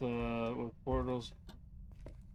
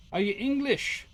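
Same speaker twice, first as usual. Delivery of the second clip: loud mock call; 11 semitones higher, 8 dB louder than usual. Spirit box question, loud.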